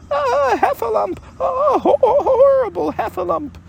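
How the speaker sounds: loud, squealing, stammering terror; Piglet crying out. A narrator's reading. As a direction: raise the alarm in a very high piglet voice. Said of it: "cried Piglet".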